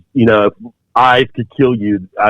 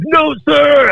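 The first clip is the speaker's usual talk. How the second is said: screaming refusal. Retold or imitated. Recruit pinned down screams back at instructors. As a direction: scream no with everything. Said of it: "I'm screaming".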